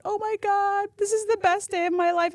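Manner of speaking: high, teary mock sob of joy; imitating her crying. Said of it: "she's crying".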